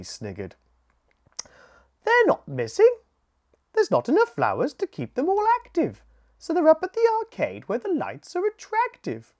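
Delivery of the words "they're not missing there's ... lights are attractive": high, loud, quick bee voice; a queen bee brushing off a silly rumour. Acted. Queen bee explaining where her bees went, high and quick.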